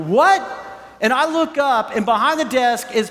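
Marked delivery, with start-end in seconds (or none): 0.1-0.5 high, punchy "what" of surprise; retold astonished outburst; startled reaction. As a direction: react as if caught off guard. Startled at hearing his name.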